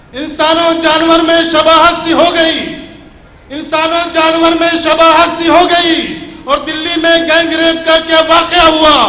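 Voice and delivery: loud declaiming to the crowd; stately nazm recitation. Acted. Nazm repeated at full force. (زوردار بلند آواز میں نظم خوانی)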